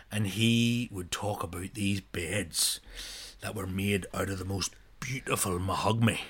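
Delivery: slow drawn-out "mahogany"; slow, lightly mock granda. Grandfather praising furniture, slow admiring drawl. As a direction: savour "beautiful mahogany" slowly like granda. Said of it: "my granda".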